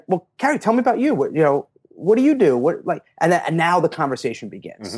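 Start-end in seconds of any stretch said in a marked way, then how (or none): 0.1-3.0 high, bright-eyed curiosity, asking about her; eager interest. Retold or imitated. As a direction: show keen interest in Carrie. Acting out his warm reply.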